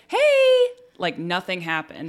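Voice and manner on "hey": much higher, louder chirpy greeting; bright cheery mom. Retold or imitated. Mom greeting the kids brightly and much higher, as if nothing happened. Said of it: "and she's like".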